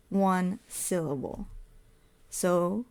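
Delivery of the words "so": ordinary talk, nothing marked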